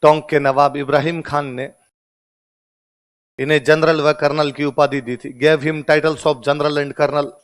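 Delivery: very slow, each word spaced out for writing; slow classroom dictation, Hindi then English. Shown as a demonstration. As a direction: dictate it so the class can copy every word.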